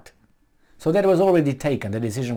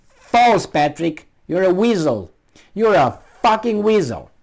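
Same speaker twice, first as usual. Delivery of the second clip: louder, higher, heated insult; angry scolding; 5 semitones higher, 5 dB louder than usual. Real, not acted. Vlogger berating the article author, angry and loud. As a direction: snap at the author in real anger.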